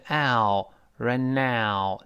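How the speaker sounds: "ow" and "right now" very slow, gliding wide open; stretched "ow" vowel. Shown as a demonstration. Teacher correcting students' "right now", stretched ow. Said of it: "还是ow".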